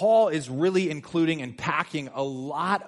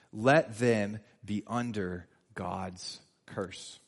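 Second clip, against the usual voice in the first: slow solemn read; 7 semitones lower, 4 dB quieter, much slower than usual. Shown as a demonstration. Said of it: "repeated curse, slower, lower".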